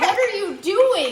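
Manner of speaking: loud, high, drawn-out protest; mock outrage. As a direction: cry out in playful indignation. Mock outrage over baking measurements.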